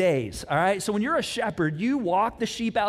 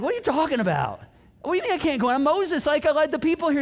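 high, louder, incredulous retort; hammed-up outrage, pitched up and pushed; mock indignant protest; 8 semitones higher, 4 dB louder, faster than usual. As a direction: play an affronted Moses pleading his case. Moses protests God's refusal.

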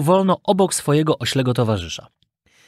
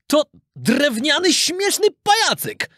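high, slow, astonished exclamation; horrified merchant; 12 semitones higher, slower than usual. A narrator's reading. Merchant discovering a wooden puppet instead, horrified. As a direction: draw out the discovery, pitched way up. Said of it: "zawołał przerażony kupiec".

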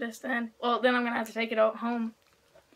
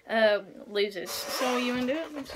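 ordinary delivery

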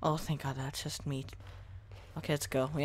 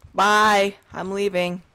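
loud, raised playful farewell; calling out; 5 semitones higher, 11 dB louder, slower than usual. Pretend. Player as little kid leaving parents, calling out loudly. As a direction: shout a goodbye across the distance.

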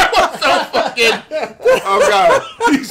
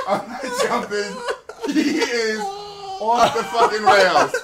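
laughing; 8 semitones higher, slower than usual. Real, not acted. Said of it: "He's losing it".

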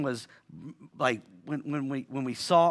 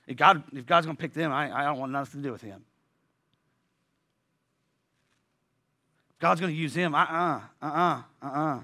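sulky refusal; faster than usual. Retold or imitated. Voicing a sulky believer refusing.

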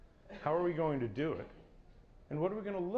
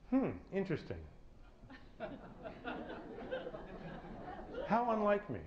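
higher, amused musing aloud with a laugh; mock-philosophical musing; 7 semitones higher than usual. Shown as a demonstration. Speaker models for the audience a detached philosophical reaction. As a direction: shrug it off like a curious philosopher, chuckling. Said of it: "I'd like you to say".

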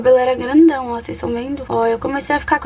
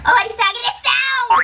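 mock outrage; 10 semitones higher than usual. Real, not acted. Daughter protesting at her mother's rough treatment, high mock-outraged cry. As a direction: cry foul in a high-pitched voice.

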